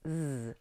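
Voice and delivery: "z" said as a soft buzzing z; buzzing z sound. Shown as a demonstration. Teacher to student, a quiet buzzed "z". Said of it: "a two over the s".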